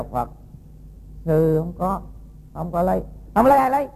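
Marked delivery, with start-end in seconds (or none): 3.3-3.9 much higher and louder, a sharp retort; angry shout. Acted. The woman shouts back at the monk who denies stealing, furious. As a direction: fling the question back at him in fury.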